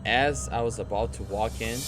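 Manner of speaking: ordinary talk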